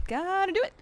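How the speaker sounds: "Gotta do it" sung up high and bouncy; high sing-song. Shown as a demonstration. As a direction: lift "Gotta do it" into a high playful rise. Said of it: "repeats it higher".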